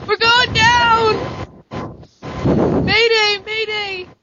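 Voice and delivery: high, loud mock panic; bracing for a crash. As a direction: ham up a falling plane. Mock plane crash from mic noise.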